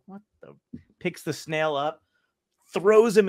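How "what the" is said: baffled under the breath. Retold or imitated. Man baffled by the snail.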